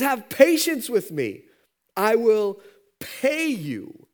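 high, loud, slow begging; slow pleading. Acted. Beaten buddy begs the servant for time, slow high pleading. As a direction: stretch out each word as you plead.

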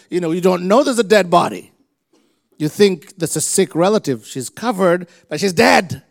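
loud, driving preacher voice; preaching. Acted. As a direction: preach it with full force. Preaching at full force.

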